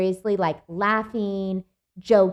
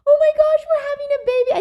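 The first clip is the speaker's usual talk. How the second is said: much higher, louder joyful squeal; overjoyed squeal; 9 dB louder, faster than usual. Retold or imitated. Sister squealing with joy as the water breaks. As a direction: burst out with tearful joy.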